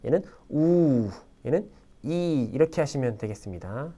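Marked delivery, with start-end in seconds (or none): 0.5-1.1 우 held long and drawn out; long vowel. Shown as a demonstration. Teacher shows students a long vowel, drawn-out 우. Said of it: "길게 준 겁니다". 2.0-2.5 이 held long as one steady vowel. Shown as a demonstration. Teacher shows students a long vowel, drawn-out 이. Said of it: "길게 준 겁니다".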